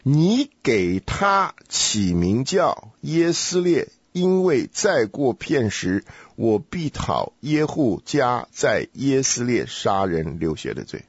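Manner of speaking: very slow, deliberate recitation; slow careful reading. Shown as a demonstration. Teacher rereads the verse as a hint, slow spaced-out reading. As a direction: spell the verse out slowly, word by word. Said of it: "我们要是慢慢读的话".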